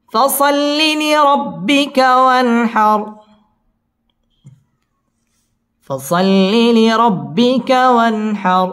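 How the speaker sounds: slow melodic chant, long held vowels; Quran recitation. Shown as a demonstration. Teacher models the verse for students, slow chanted tajweed. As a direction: intone each word, drawn out and high.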